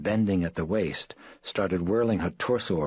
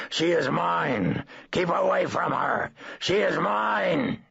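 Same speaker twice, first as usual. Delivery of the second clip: slow, much higher, louder possessive roar; demonic bellow; 13 semitones higher, 4 dB louder, slower than usual. Acted. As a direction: claim her like a demon, roaring. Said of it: "she bellowed in a coarse and powerful voice".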